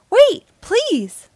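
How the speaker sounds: loud, high desperate shout. A narrator's reading. Tiny ant stopping a descending shoe, desperate shout. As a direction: shout to halt someone, pleading. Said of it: "Red shouted".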